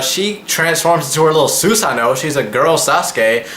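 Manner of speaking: ordinary delivery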